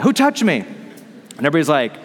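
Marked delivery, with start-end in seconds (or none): 0.0-0.6 urgently calling out "touched" over the crowd; insistent repeat. Acted. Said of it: "Jesus does that".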